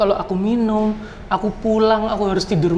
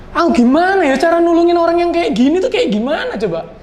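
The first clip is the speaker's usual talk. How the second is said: high, louder helpless plea; retold panic; 10 semitones higher, 4 dB louder than usual. Recalling how he looked after a drunk friend, in mock panic. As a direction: re-enact being at a loss, voice pitched up.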